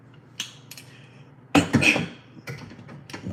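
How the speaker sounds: hard coughing fit, food down the wrong pipe; choking cough. Real, not acted. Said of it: "it went down the wrong".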